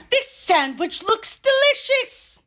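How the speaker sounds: high, loud, slow cartoon villain relish; gloating villain. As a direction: savour it like a hammed-up villain.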